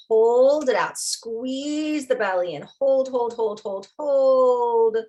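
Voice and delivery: insistent repeats ending on a long, loud "hold"; urging coach. Real, not acted. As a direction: coach them through holding the breath. Coaching through a breath hold.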